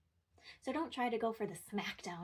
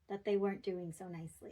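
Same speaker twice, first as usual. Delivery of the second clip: normal talking voice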